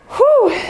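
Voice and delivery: high, loud whoo of effort mid-set; breathless high whoop between reps; exertion whoo. Real, not acted. Exhale between workout reps.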